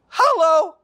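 delighted hello. Real, not acted. Pastor's relief at cancelled meeting.

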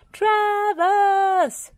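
very high, loud drawn-out yell. Acted. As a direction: shout at the top of your voice. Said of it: "yelled Farmer Pickles at the top of his voice".

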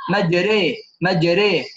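مجري with the alif leaned toward an e sound, madre; imala. Shown as a demonstration. Teacher correcting a student's answer, saying مجري with imala. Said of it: "بل بالإمالة".

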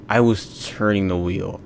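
ordinary delivery

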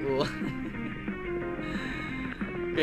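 usual speaking voice

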